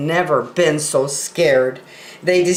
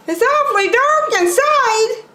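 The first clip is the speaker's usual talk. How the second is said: high buffalo voice with mild unease; a high character voice peering into the dark; Boris Buffalo uneasy. Acted. Boris Buffalo at the dark cave mouth, high uneasy voice.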